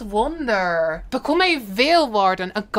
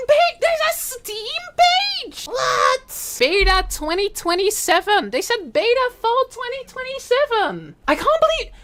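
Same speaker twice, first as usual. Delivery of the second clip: excited squeal; 10 semitones higher than usual. Real, not acted. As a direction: squeal with real surprise at the news. Surprise at game announcement.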